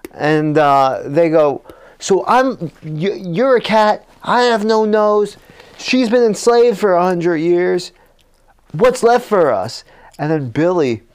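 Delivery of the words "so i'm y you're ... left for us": high-pitched, worked-up lament; mock despair. Hero listing their ruined lives, mock despairing. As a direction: pile up the woes, pitch raised.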